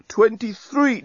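high-pitched, agitated countdown number; flustered moaning. A narrator's reading. Bomb losing its place in the countdown, moaning high. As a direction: moan the number, thrown off count. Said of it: "moaned the bomb".

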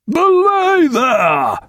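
high, loud, long-drawn roar; Cape Horn roar. A narrator's reading. Captain silencing his crew, roaring. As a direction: bellow the order as if over a gale. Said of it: "roared Jack in a Cape Horn voice".

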